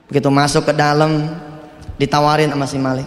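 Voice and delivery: ordinary delivery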